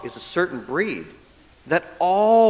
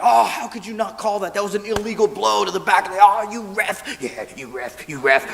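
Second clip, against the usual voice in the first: mock angry fan; 4 semitones higher than usual. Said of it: "you get all mad".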